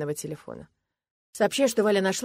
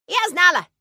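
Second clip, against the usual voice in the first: put-on high, loud childish cry of victory; delighted little girl; 13 semitones higher, 12 dB louder, slower than usual. A narrator's reading. Girl winning father's agreement, delighted. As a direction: crow it happily.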